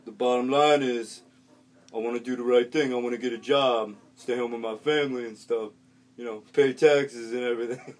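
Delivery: loud, deadpan street voice copying a share; earnest street kid. Retold or imitated. Kid copying the earlier share word for word, loud and earnest. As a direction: parrot the other guy's speech with a straight face.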